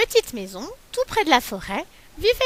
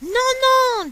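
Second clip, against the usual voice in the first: much higher, louder no; high refusal; 12 semitones higher, 5 dB louder than usual. Acted. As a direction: squeal it. Galette refuses bear.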